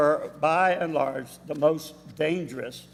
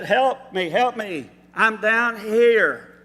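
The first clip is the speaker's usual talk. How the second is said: louder, higher, stretched shout upward; calling for help; 5 semitones higher, 7 dB louder, slower than usual. Retold or imitated. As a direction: yell up the cliff wall for rescue. Jack calls up from cliff.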